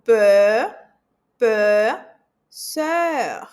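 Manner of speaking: peur and sœur with a rounded eu, loud; teacher drills the eu vowel; eu sound demo. Shown as a demonstration. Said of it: "tem som de E".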